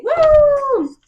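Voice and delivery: high, loud cheer of delight; excited whoop. Real, not acted. Cheering about the book.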